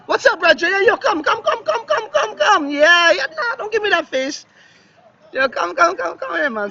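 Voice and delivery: high, excited calling out to a friend. Real, not acted. Greeting his friend by the pool, calling him over excitedly.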